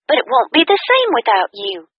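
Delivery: bright, high, fast upbeat friend voice; cheerful friend. A narrator's reading. Kathy invites her friend to dinner, cheerful and high.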